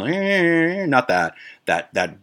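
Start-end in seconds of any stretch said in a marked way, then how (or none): 0.0-0.8 high, drawn-out mock siren wail; imitated full police siren. Explaining which siren the police used, imitated full siren wail. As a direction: mimic a long siren whine with the voice. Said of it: "not the full siren".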